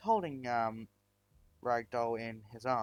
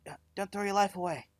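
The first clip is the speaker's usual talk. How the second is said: higher, louder desperate plea; pleading; 8 semitones higher, 3 dB louder than usual. Acted. Injured Ragdoll begging him not to fight, desperate plea.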